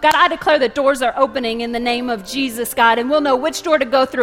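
loud, urgent declaring prayer; impassioned preacher praying aloud; fervent prayer. Real, not acted. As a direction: pray out boldly, pressing each plea. Leads a prayer.

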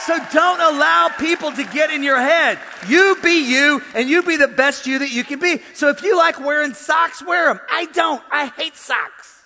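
loud, high, emphatic sermon shout; full-force preaching. Real, not acted. Preacher telling congregation the truth about themselves, full-force shouting. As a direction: build and belt it out to the whole room.

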